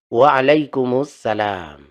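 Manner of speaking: Waalaikumsalam slow, linked through the alif lam; joined reading. Shown as a demonstration. Teacher repeating the correct reply for students, slow. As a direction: draw out Waalaikumsalam carefully. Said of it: "harus disambung".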